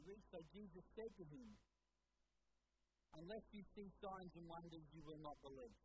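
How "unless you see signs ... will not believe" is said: lower-pitched, measured scripture reading; solemn quotation. Acted. Preacher reading Jesus' reply to the father, solemn and low. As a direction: read it out soberly, pitch dropped. Reads Jesus' words, first take.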